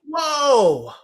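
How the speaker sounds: loud high amazed whoa; surprised whoa. Real, not acted. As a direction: gasp it out, amazed. Hearing about fourteen-hour stream.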